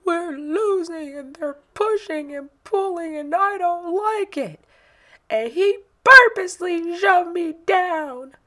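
put-on high, loud aggrieved little boy; upset child. Son explains to mom he was shoved, high upset voice. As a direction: complain like a hurt kid. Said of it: "what is this about".

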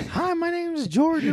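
put-on toddler voice. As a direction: introduce yourself as an innocent toddler. Voicing the toddler introducing himself.

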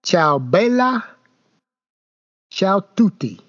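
slow, halting Italian phrases; halting phrasebook tourist; broken Italian. Shown as a demonstration. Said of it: "as I say in my broken Italian".

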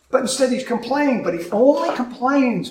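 ordinary talk

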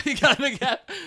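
high, loud laughing echo. Real, not acted. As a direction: crack up while repeating it. Echoing co-host, cracking up.

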